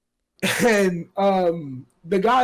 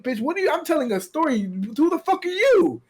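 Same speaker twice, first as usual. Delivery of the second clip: indignant comeback; 5 semitones higher than usual. Retold or imitated. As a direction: fire back offended, pitch climbing on the last question. Retold angry comeback on train.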